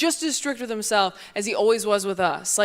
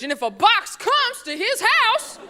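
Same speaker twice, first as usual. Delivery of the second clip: mock dad voice; 10 semitones higher, 5 dB quieter, slower than usual. Dad's logic mocked.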